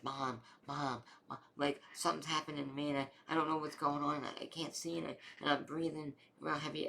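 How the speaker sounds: retold panicked cry. Son phoning his mom while struggling to breathe, mildly acted panic. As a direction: re-enact a frantic plea for help. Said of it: "I was screaming and crying".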